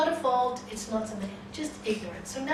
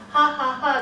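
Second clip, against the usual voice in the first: loud, higher, spoken fake laugh; mocking laughter; 5 semitones higher, 10 dB louder, faster than usual. Friends laughing at the man when God hasn't come, mocking. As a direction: jeer with a hammed-up ha-ha. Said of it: "his friends are laughing".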